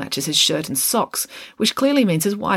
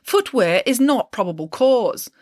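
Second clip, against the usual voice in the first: slow, higher, huffy protest; indignant suspect voice; 7 semitones higher, slower than usual. A narrator's reading. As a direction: lay down the law like an offended rich man.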